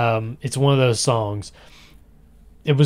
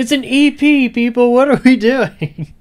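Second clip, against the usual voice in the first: much higher and louder, pleading; high mock exasperation; 11 semitones higher, 5 dB louder than usual. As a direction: squeak it out like a baffled fan.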